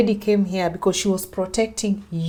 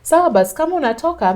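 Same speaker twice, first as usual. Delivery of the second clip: loud, high repeat of the brush-off; husband repeating it loud and careless; dismissive husband; 8 semitones higher, 8 dB louder, faster than usual. Retold or imitated. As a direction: shrug her off again, louder. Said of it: "that's what he told me".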